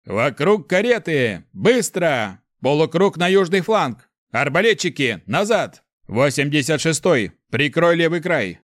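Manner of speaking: high, loud battlefield orders; decisive commander shouting over battle; commanding shout. A narrator's reading. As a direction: call out short orders to the fighters with authority. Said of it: "властный и решительный голос".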